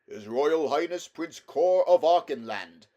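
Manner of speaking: high, loud ceremonial proclamation; herald's announcement. A narrator's reading. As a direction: proclaim it like a royal herald outside the gate. Herald announces prince from outside.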